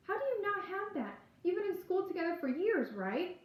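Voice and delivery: high, anxious rush of questions; panicked. A narrator's reading. Girl pressing a boy about her missing friend, panicked. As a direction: pitch jumps up in alarm. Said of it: "I ask, panicked".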